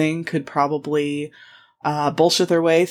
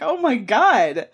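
high, drawn-out scandalised reaction; mock shocked; 7 semitones higher, slower than usual. Researcher reacting to racy nun stories, mock scandalised. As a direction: stretch it out in delighted shock.

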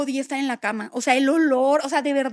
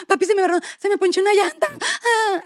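high, whining spoiled-daughter plea with a laugh; whiny daughter; 5 semitones higher than usual. Retold or imitated. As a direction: play the helpless little girl begging papi.

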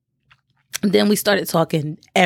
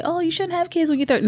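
high, quick mimicked objector; mock objection; 10 semitones higher, faster than usual. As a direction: parrot the naysayers in a higher, hurried voice. Voicing people who disagree with her.

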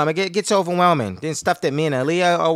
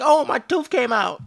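put-on higher, slow, sing-song kid voice; teasing kid voice; 8 semitones higher, 3 dB louder than usual. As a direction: play the little prankster announcing her tooth.

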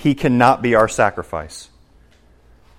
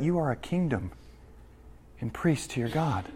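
much softer, turned to the listeners; quiet personal repeat; 15 dB quieter than usual. Acted. Preacher applies the verse to his church, softly. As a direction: bring it down and speak it to them directly. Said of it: "Do you hear that?".

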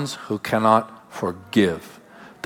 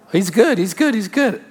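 mocking singsong; 7 semitones higher than usual. Mocking shallow believer.

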